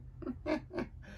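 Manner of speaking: high breathy mad cackle; villain cackle. Acted. Villain cackling after the crash. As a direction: cackle like a gleeful comic villain. Mocking laugh in story.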